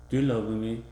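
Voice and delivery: usual speaking voice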